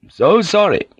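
politely refuse, brooking no argument; firm samurai refusal. A narrator's reading. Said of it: "this samurai said firmly".

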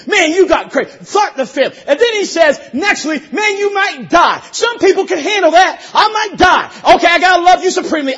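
shouted, high-pitched preaching with bursts of laughter; fiery preaching. Acted. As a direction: preach it hard to the whole house. Preaching at full force.

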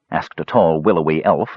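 usual speaking voice

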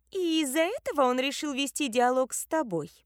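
high voice of doubt and disdain; little one's disdainful disbelief; incredulous, scornful. A narrator's reading. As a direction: question it high, half disbelieving, half contemptuous. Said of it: "Не то недоверчиво, не то презрительно вопросила".

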